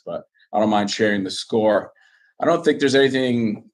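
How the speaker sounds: ordinary talk, nothing marked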